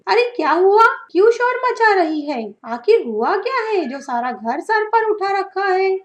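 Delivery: high, loud shout from another room; irritated shout. Acted. Old mother shouting from the back room, loud and irritated. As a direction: call back crossly, annoyed at the noise.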